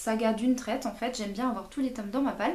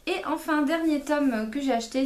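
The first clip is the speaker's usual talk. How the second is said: ordinary talk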